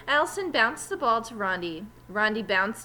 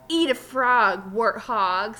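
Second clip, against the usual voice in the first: rude taunt; 7 dB louder, slower than usual. Acted. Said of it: "said Terrence".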